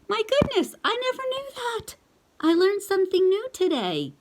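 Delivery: high, loud amazed gasp of a line; mock surprise. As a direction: play it big, as if astonished. Reader acts surprised at name.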